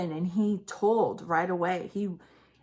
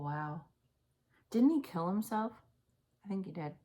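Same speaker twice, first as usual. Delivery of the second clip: ordinary talk, nothing marked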